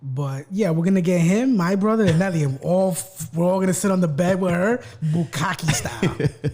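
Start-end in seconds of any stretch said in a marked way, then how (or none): 5.0-6.5 drawn out through a laugh; slow, laughing delivery of the joke's punchline. Acted. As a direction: stretch it slowly while laughing. Joke punchline repeated.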